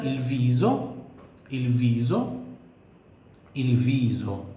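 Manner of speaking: il viso said clearly with rising ends; teacher drills il viso for students to repeat; repeat model. Shown as a demonstration. Teacher drills il viso for repetition.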